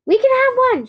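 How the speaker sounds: high, loud, thrilled; excited announcement. A narrator's reading. Mallory tells the club, high and loud. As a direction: announce it like big news. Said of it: "she announced".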